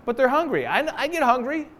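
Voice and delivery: ordinary talk, nothing marked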